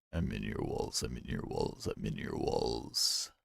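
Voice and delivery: mock horror deep voice; creepy deep wall lurker. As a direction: growl it low like something in the walls. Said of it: "that voice swapping".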